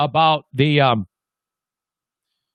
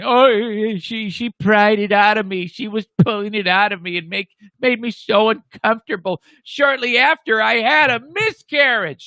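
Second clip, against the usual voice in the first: higher, mocking imitation of a pop diva; 5 semitones higher than usual. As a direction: pitch it up and mock her complaint. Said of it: "[name] is throwing [name] under the bus, saying".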